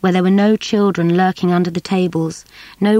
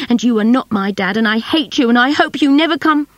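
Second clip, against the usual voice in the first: furious; 5 semitones higher, 3 dB louder than usual. Acted. Saffy furious at her dad.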